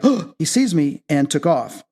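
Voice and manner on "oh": imitating a startled little gasp; quick high "oh" of being caught; mock startled gasp. Gnome startled at being seen.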